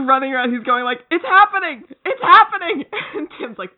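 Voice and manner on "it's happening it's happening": high, loud excited cries; acted-out thrill, voice shooting up on each repeat; excited exclaiming. Bart running around mid-fight, thrilled high cries.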